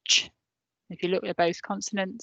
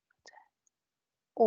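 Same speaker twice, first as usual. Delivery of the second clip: whisper. Real, not acted. Teacher whispering the cue to her pupils. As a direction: breathe it, barely voiced. Teacher prompting children to repeat.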